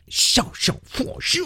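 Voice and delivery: breathy, voiceless made-up syllables; hushed nonsense sounds meant to mimic Chinese; mock Chinese gibberish. Radio host imitating the Chinese chairman, mock gibberish. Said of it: "that's my Chinese imitation".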